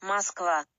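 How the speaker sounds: Moskva with stress on the end, mosk-VA; Russian pronunciation Moskva. Shown as a demonstration. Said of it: "Have a listen".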